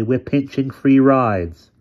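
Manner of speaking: tactless. Acted. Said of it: "Mike said tactlessly".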